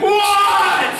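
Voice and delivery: loud, high, drawn-out exasperated yell. Retold or imitated. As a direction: lose it and bellow down the stairs. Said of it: "as I'm yelling down the stairs".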